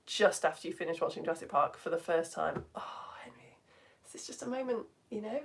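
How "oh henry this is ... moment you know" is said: whisper. Acted. Awestruck aside about first viewing.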